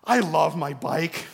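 put-on slow, drawn-out kid's declaration; winded young son. Sweating son confiding in his dad, slow and winded. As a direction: play the boy pausing for breath, then savoring each word. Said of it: "like, sweating 'cause he's been going back and forth".

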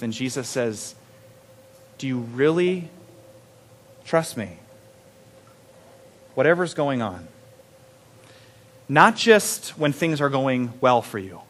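soft, low sermon voice; hushed preaching. Acted. Pastor softly pressing the question on the congregation. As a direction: draw the room in, quietly.

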